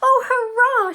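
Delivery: very high, loud cheer; joyful shout. A narrator's reading. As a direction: shout with girlish delight. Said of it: "shouted Ethel".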